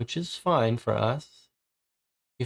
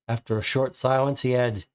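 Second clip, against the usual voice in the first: ordinary talk, nothing marked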